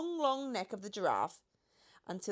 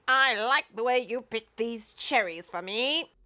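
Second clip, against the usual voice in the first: put-on louder, higher old Duke; the Duke's voice; 4 semitones higher, 8 dB louder than usual. A narrator's reading. The Duke praises the cherry picking, in character.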